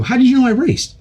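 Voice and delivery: put-on boy stunned his father found out; startled question. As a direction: squeak it out, amazed he knows. Kid caught, asking his dad.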